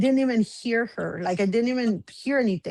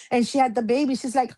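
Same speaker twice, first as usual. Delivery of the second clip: ordinary talk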